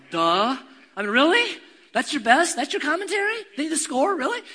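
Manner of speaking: loud, high incredulous scoff; mocking disbelief. Real, not acted. Pastor mocking a football announcer, loud scoffing disbelief. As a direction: scoff at the obvious comment.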